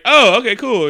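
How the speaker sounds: high, loud imitation of Peggy; mock breezy reply. Peggy hearing he will be late, mock breezy high voice. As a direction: pitch it up, over-casual.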